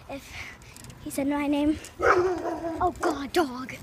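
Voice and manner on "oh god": ordinary talk, nothing marked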